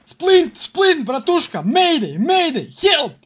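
loud, pleading calls for help; desperate shout. Pretend. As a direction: beg for help at full voice. Wounded, begging for splint.

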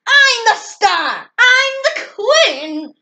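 high, loud furious cry; shriek. Acted. Pig seeing red at the photographer, a high loud shriek. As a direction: scream it in a jealous fit. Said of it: "he shrieked".

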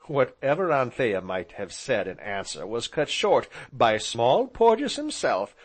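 usual speaking voice

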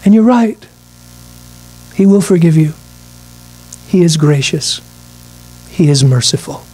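measured statements, stepping down in pitch; sober concession. Real, not acted. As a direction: concede each point gravely, with pauses between. Sober concession answering the breezy attitude.

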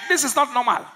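loud, high excited preaching; full-force preaching. Real, not acted. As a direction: preach it with rising fire.

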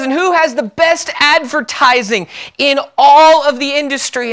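acted pep-rally question, high and loud; rallying CEO. CEO to eager salesmen, high, loud rallying voice. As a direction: work up the sales crowd. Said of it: "the C E O stood up before the eager salesmen".

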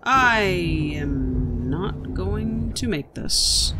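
very slow, stretched words; drawn-out doubt. Real, not acted. Player doubting the jump mid-attempt, slow and strained out. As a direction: stretch every word through the attempt.

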